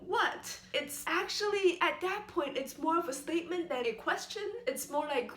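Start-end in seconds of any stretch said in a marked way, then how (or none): 0.1-0.6 "what" starting high and falling sharply; fourth tone. Shown as a demonstration. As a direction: drop "what" from a high pitch, more statement than question. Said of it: "And the fourth tone".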